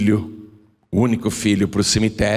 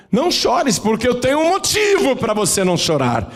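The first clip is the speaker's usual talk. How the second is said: urgent reassurance; 12 semitones higher than usual. Acted. Jesus answering the mother, voiced.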